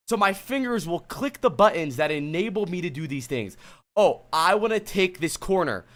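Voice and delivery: usual speaking voice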